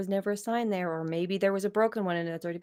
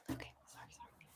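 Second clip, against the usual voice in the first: whispering; 23 dB quieter, faster than usual. Real, not acted. Apologizing quietly while fixing camera.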